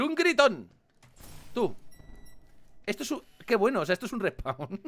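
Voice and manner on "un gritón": high drawn-out game-show shout of gritón; announcer button call. Shown as a demonstration. Gamer wishing for a soundboard, game-show shout. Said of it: "Para decir".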